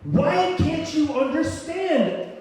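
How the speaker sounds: slow, high, loud pleading; drawn-out, exasperated high yell; inner scream. Retold or imitated. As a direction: wail every word in desperation. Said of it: "I'm just screaming".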